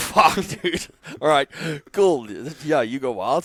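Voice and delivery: retelling how he reacted when his partner said he'd rebuild everything, exasperated and amused; mock exasperated outburst. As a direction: throw up your hands and laugh it off.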